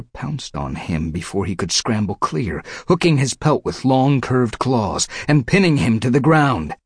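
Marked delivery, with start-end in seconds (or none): none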